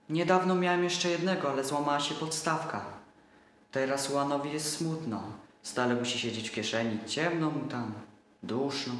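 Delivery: put-on little boy voice. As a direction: voice him like a trusting small boy.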